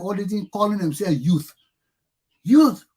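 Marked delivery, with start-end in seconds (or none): 2.4-2.8 loud, high mocking echo; scornful echo. Real, not acted. Mocking old men calling themselves youth, scornful echo. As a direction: throw the word back in scorn.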